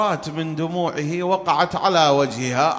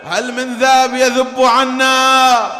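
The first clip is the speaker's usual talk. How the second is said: slow, higher, louder appeal across the camp; loud call for help; 6 semitones higher, 5 dB louder, slower than usual. Acted. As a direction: cry out to whoever will defend. Husain calls for defenders.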